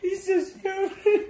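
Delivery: high, slow words through laughter; losing it laughing. Real, not acted. Cracking up mid-challenge.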